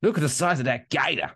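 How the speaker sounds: put-on Australian accent. Host repeats police post about the gator, mock Australian accent. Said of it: "doing the Australian accent now".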